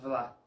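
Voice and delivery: "vla" with a real v, lip on teeth; "vla" with buzzing v, not b; Portuguese "vla". Shown as a demonstration. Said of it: "va a tener sonido de".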